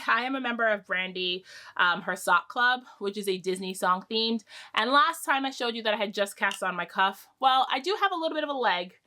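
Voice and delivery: normal talking voice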